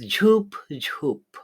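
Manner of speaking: ঝুপ ঝুপ drawn out long and slow; stretched syllables. Shown as a demonstration. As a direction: stretch each ঝুপ to fill the beat. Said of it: "আমরা টেনে ঝুপ ঝুপ করে উচ্চারণ করি". (ঝুপ ঝুপ টেনে উচ্চারণ)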